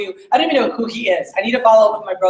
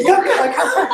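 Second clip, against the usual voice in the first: high, giggling surprise at the brand; laughing delight; 11 semitones higher than usual. Real, not acted. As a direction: laugh the name out, thrilled to see it. Spots Cutco knives, delighted laughing.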